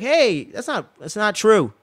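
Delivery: high, protesting denial; defensive denial. Retold or imitated. Rhaenyra denies the rumor to her friend, high flustered protest. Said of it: "And she's like".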